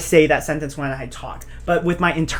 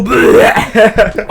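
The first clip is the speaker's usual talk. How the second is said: drawn-out loud mock burp; imitated burp; 5 semitones higher, 6 dB louder than usual. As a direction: blurt out an exaggerated burp noise. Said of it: "Oh, your burp".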